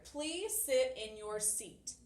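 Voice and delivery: slow, deliberate classroom example; slow example read. Shown as a demonstration. Teacher reading an example command, slow and clear. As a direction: spell the command out slowly for the class. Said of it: "this example I have right here".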